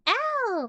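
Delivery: high, loud ow; sudden high-pitched cry of pain; startled yelp. Real, not acted. Reader asking why the bonk, sudden loud yelp.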